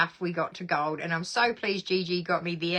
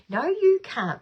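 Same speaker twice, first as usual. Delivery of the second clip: mock scolding; 9 semitones higher, 5 dB louder than usual. Real, not acted. Answers Michael's comment.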